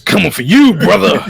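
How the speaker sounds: high, loud Hulk Hogan style yell; wrestling promo. Retold or imitated.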